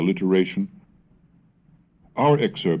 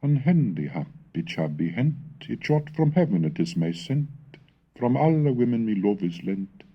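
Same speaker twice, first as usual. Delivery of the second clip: lyrical recital, higher and louder at the start, then settling; poem recitation; 7 semitones higher, 3 dB louder than usual. Acted. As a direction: recite it like a spring love song. Recites the Middle English poem.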